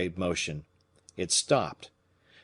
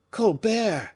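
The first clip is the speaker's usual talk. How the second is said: startled repeat; 13 semitones higher, 3 dB louder than usual. Acted. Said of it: "repeated Fouquet".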